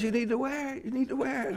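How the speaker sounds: high, laughing wife's voice; wife laughing as she insists he wear the tie; imitating wife. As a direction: tease him like his wife would. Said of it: "she goes".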